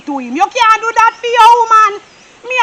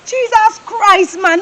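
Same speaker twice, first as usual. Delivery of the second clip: normal talking voice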